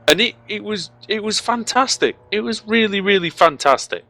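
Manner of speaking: excited. Real, not acted. Commentator thrilled by close finish.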